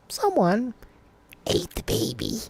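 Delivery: odd put-on poet's voice, starting high and loud; Shel Silverstein. Dad showing how he read to his kid, poet impression. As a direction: mimic a sing-songy rhyming-book reader, dropping after the first word. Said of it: "reading it like Shel Silverstein's voice".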